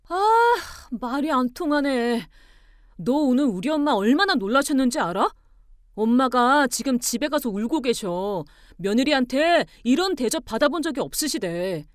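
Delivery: loud, exasperated scolding; angry reproach. Acted. Husband blaming wife for his mother's tears, angry.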